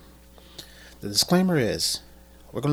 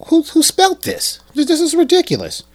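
exasperated; 13 semitones higher, 6 dB louder, faster than usual. Real, not acted. Exasperated by badly written cue card.

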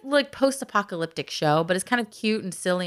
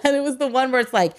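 usual speaking voice

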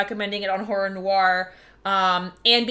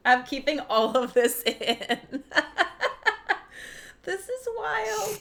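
laughing; 5 semitones higher, 5 dB quieter, slower than usual. Real, not acted. Laughing while holding sneeze.

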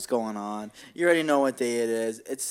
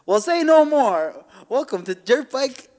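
loud, higher-pitched hype pitchman; mock radio ad; 6 semitones higher, 5 dB louder than usual. Podcaster improvising a fake dirt bike sponsor read, hyped-up ad voice. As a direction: sell cheap bikes like a hard-sell commercial.

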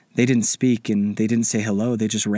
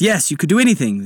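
quickly; 7 semitones higher, 4 dB louder than usual. Acted. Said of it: "the spirit guide said quickly".

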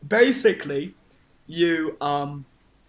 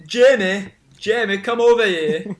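calling out; 4 semitones higher, 8 dB louder than usual. Acted. Innkeeper calls server across inn.

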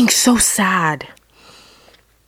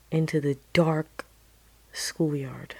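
ordinary talk, nothing marked

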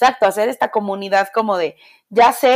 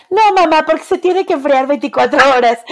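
put-on higher, childlike insistent voice; imitating the daughter; 7 semitones higher, 3 dB louder than usual. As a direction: play the little girl explaining to mom. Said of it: "Y Ana Lucía".

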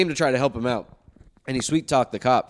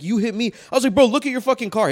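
angry insistence; 9 semitones higher, 4 dB louder than usual. Retold or imitated. Arguing with other driver.

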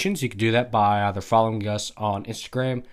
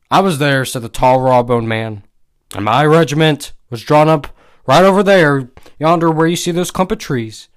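emphatic, louder storyteller reading; story narration; 6 dB louder than usual. A narrator's reading. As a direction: read the old war tale out with relish. Reads a story aloud.

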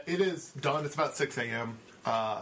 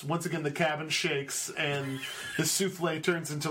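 ordinary talk, nothing marked

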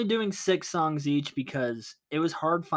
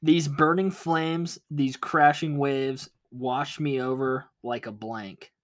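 slow lyric reading; slower than usual. Acted. Reading lyric clue slowly.

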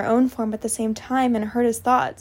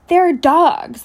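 louder, higher, emphatic disgust; 4 semitones higher, 7 dB louder than usual. A narrator's reading. Narrator voices Rose dismissing the wolves, disgusted. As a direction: spit it out in disgust. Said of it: "The tone of disgust was back".

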